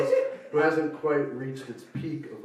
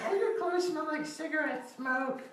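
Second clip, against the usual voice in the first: much higher mock sister voice; mock nagging sister; 5 dB quieter than usual. Sister complaining about his smoky clothes, high whine.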